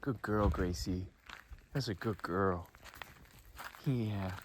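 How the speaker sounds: soft, low, tender praise; praising the dog. Real, not acted. Owner praises her dog after the scare, soft and tender. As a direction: soothe the dog gently.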